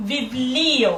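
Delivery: লিও in বিবলিও drawn out long; টেনে বলা. Shown as a demonstration. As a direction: stretch the stressed লি of বিবলিও. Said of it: "এই যে আমি কিন্তু একটু টেনে বললাম". (বিবলিও-র লি টেনে বলা)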